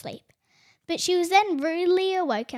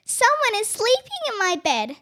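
high small bear voice; baby bear finding Goldilocks asleep; little bear voice; 7 semitones higher, 4 dB louder than usual. A narrator's reading. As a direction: say it like a tiny cub. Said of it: "came a little voice".

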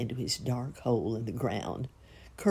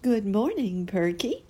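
butterfly mother greeting; 7 semitones higher, 3 dB louder than usual. A narrator's reading. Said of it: "said the beautiful creature".

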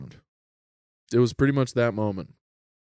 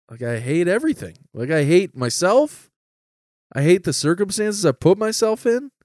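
raised, worked-up mock outpouring; distraught confession; 7 semitones higher, 5 dB louder than usual. Kid venting to family at intervention, mock-distraught outburst. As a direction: pour it out high and loud, hammed-up.

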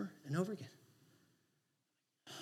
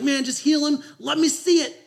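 higher, louder pleading cry to God; pleading prayer; 8 semitones higher, 7 dB louder than usual. Retold or imitated. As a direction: beg for the miracle out loud. Begging God for healing.

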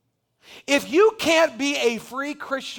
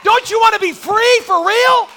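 building shout. Real, not acted. Preacher pleading with congregation, building shout. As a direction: drive it up to a shout.

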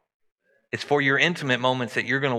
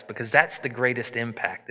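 ordinary talk

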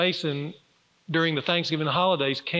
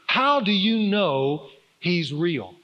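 drawn-out, weighty question; slow emphatic question; slower than usual. Real, not acted. Preacher putting his son's question to the congregation, slow and emphatic. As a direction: stretch each word for the listeners to ponder.

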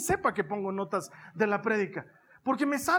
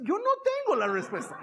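hammed-up, high-pitched helpless outcry; comic desperate protest; 11 semitones higher than usual. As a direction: voice leaps into a high squeal. Comic protest at expectant looks.

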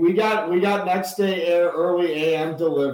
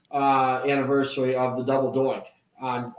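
ordinary delivery

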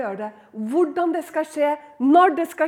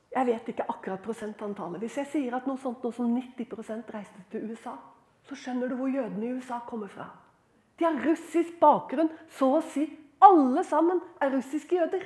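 ordinary talk

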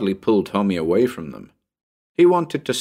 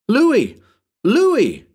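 put-on high, loud child's call; insistent calling; 6 dB louder than usual. Tommy calls up to the roof, insistent calling. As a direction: call the name sharply up to someone above. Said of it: "was calling my name insistently".

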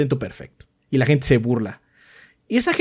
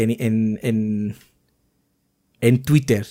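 ordinary delivery

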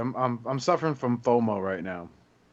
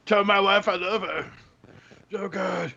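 high dying gasps, fading at the end; hammed-up dying man's last words; wounded soldier gasping; 5 semitones higher, slower than usual. Landscaper dying joke.

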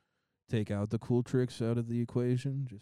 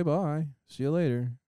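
ordinary talk

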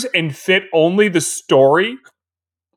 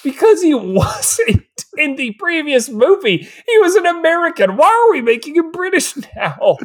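high-pitched incredulous protest; baffled; 10 semitones higher than usual. Real, not acted. Podcaster questioning why the movie made him British, baffled. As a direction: voice climbing in exasperated confusion. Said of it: "I'm so confused".

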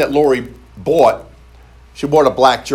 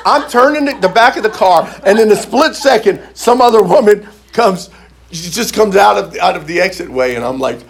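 ordinary talk, nothing marked

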